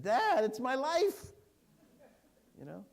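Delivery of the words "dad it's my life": much higher, louder and slow, drawn out; teen daughter's protest. Retold or imitated. Father asks daughter to wait three days, she protests much higher and louder. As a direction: play the teenager crying out that the phone is her whole life.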